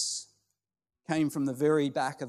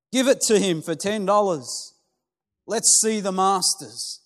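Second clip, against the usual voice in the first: higher, loud, slowing on the last words; heckling shout; 4 semitones higher, 4 dB louder than usual. Acted. Voice at the back heckling the auctioneer, loud impatient shout.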